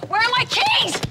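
furious, loud demand; angry shout. Acted. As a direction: yell it, demanding the keys. Father yelling at mother for keys.

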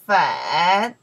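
粉 loud, dipping low then rising; isolated 粉 with a full falling-rising tone; third tone. Shown as a demonstration. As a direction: exaggerate the low dip on 粉 for learners. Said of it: "the third tones".

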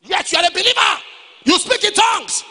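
loud, high-pitched preaching cry; challenging shout. Real, not acted.

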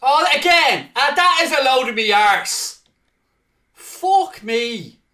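loud, high exasperated yelling; furious shout. Real, not acted. Gamer raging at FIFA after conceding again, loud. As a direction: rage at the game, full volume.

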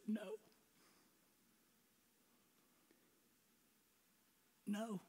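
very soft, hushed no; small, reluctant no with long pauses; quiet refusal. Acted. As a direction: refuse under your breath. Acted refusal of the Spirit's prompting.

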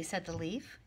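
ordinary delivery, nothing marked

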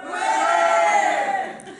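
high, airy imitation of a roaring crowd; crowd cheer. Workers answer their speaker, imitated crowd cheer. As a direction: cheer like a whole hall in the distance. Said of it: "tout le monde crie".

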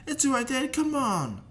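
high, loud put-on child voice; pleading kid. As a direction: plead up high like a boy to his dad. Child pleads with his dad.

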